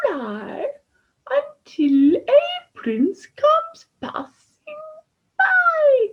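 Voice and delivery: high, loud, slow witchy cackle; cackling bad fairy. A narrator's reading. Bad fairy threatening the princess, slow loud cackle. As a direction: drag out the threat with wicked glee.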